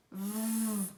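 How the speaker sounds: sustained buzzing "V"; demonstrated V sound. Shown as a demonstration. Teacher contrasting W's sounds, long buzzed V. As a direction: buzz the "V" with no vowel. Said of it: "tem um som de V".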